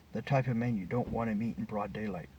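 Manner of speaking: ordinary delivery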